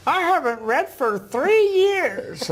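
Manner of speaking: put-on high, slow frail old lady; old woman voice. Guest imitates the elderly reading student, high and slow. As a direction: voice the ninety-four-year-old gently. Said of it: "I'm gonna use a voice that s-, sounds like her".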